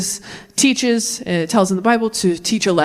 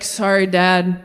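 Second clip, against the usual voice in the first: mock sorry; 6 semitones lower than usual.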